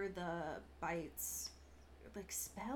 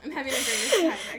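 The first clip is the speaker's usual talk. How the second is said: laughing mock cheer; 4 semitones higher, 12 dB louder, much faster than usual. Playing carefree Rose.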